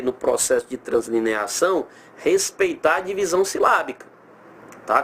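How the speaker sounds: ordinary delivery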